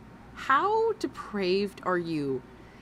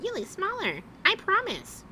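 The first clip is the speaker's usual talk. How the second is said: much higher, sugary fake comfort; mock sweet reassurance; 13 semitones higher than usual. Reactor mocking the friend's fake reassurance to the streamer. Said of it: "Talking about".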